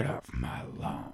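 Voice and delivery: very low, soft, slow growl; whisper-growl it far down in the throat; hushed gravel tough-guy impression; Gran Torino voice. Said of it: "he actually did sound like that".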